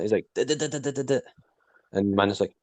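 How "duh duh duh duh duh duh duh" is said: rapid "duh-duh" rattle, pitched up; imitated rattling. Host retelling Grogu tapping the glass, imitated rattling. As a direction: imitate a little one rattling away insistently. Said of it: "he just starts rattling".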